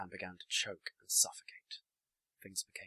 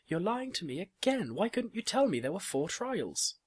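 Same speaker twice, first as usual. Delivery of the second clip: high, loud, indignant outburst; upset accusation; 12 semitones higher, 7 dB louder than usual. A narrator's reading. Marzipan confronting Caliph about lying, upset accusation. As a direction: accuse him at a high pitch.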